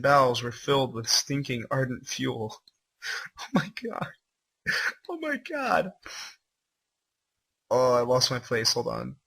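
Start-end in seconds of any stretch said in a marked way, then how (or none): 3.4-5.9 high, squeaky disbelief; disbelieving. Real, not acted. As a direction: gasp it out in horror. Horrified reaction.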